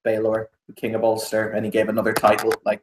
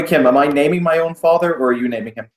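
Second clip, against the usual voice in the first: normal talking voice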